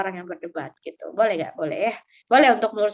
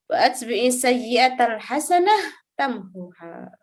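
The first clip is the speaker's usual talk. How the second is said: slow, measured phrases with long pauses; hadith teaching recitation; 2 semitones higher, slower than usual. Real, not acted. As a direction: recite and translate slowly, phrase by phrase. Recites and translates hadith slowly.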